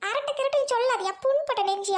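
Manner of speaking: high-pitched wounded protest; indignant retort. Acted. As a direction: snap back as the injured party. Wife retorts to husband's jibe. (கோபமாக மறுத்துப் பேசுவது)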